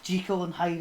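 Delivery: "Jekyll" as JEE-kul before Hyde; intended pronunciation. Shown as a demonstration. Host trying out the odd title, "Jekyll" as JEE-kul. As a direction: give "Jekyll" a long ee, like seek. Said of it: "apparently, yeah, that sounds terrible".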